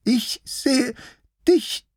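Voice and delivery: very high little sheep voice, halting; high-pitched lamb, pausing between words; hesitant sheep-lion. Acted.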